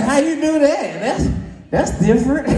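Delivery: high, laughing disbelief; high shocked laughing question. Retold or imitated. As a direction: squeak it out in amazed disbelief. Shocked reply acted in story.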